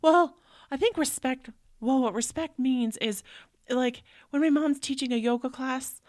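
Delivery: put-on high, loud eager kid voice; child voice. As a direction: answer like a proud little boy. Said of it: "And he goes".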